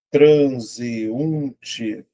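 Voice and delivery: TRANSEUNTE drawn out as tran-se-un-te; syllable by syllable. Shown as a demonstration. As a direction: stretch each syllable of TRANSEUNTE. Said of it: "Vou separar".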